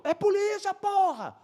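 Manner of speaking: very high, strained insistent yell; high-pitched exasperated call across a distance; re-enacted shouted confirmation. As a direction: shout the answer back emphatically.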